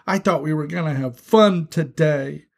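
put-on childish tearful whine; tearful complaint. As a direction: wail it like a disappointed child. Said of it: "Benny began to cry".

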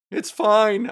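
high, loud startled yell. Retold or imitated. Telling viewers about the raccoon, yelling it again. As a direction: shout it at the raccoon. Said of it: "I yelled".

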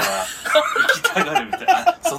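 mock whiny. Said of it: "imitating the others changing topic".

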